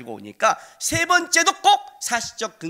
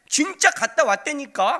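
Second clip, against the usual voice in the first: slow insistence; 7 semitones higher, 5 dB louder than usual. Retold or imitated. Son insisting.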